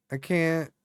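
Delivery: usual speaking voice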